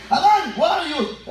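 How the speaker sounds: high, drawn-out call to Adam; llamando en voz alta. Acted. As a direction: call out as God searching for someone. God calling out to Adam. (llamado agudo y alargado)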